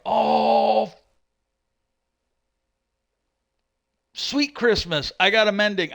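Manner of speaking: high, drawn-out happy exclamation; delighted surprise. Real, not acted. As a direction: marvel at an unexpected lucky find. Rare find during game stream.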